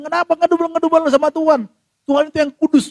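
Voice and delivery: high, loud reproach; mock scolding objector. Objector telling people not to grumble at God, mock scolding.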